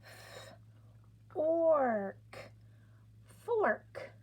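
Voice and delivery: "F" breathed, "orc" then "fork" rising high; sounding out fork. Shown as a demonstration. Teacher blending sounds for the class, rising to the word. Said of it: "touch our sounds".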